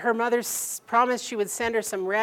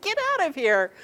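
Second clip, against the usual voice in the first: retold shooing; 8 semitones higher, 4 dB louder than usual. Shooing birds from feeder.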